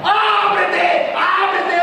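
high, loud, long-carrying yell; coach's shout. Acted. Football coach to a player on the field, shouting. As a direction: bellow it across the pitch. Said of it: "Me encanta gritar".

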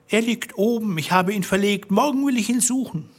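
high-pitched anxious wife's excuse; flustered wife. Acted. Wife hiding the bloody key from Bluebeard, flustered and high. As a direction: make a nervous, high-voiced excuse.

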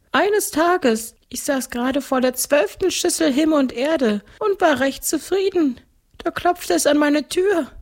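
very high ghost-count voice; gluttonous ghost count. Acted. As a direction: recount it as a high-pitched ghost nobleman. (hohe Stimme eines Gespenstergrafen)

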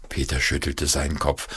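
ordinary delivery, nothing marked

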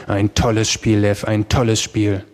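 usual speaking voice

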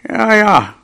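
put-on old mouse waving off nonsense; mouse grandmother. A narrator's reading. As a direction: humour the youngster without believing him. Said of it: "sagte die Mäuseoma".

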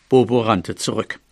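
plain reading voice

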